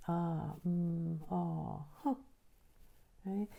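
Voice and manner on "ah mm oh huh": a string of gentle wordless sounds, ah, mm, oh, huh; sample each sound briefly, like natural reactions; demo vocal bursts. Shown as a demonstration. Teacher demonstrates vocal bursts to her audience, gentle wordless sounds. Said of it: "Vocal bursts are the non-language sounds".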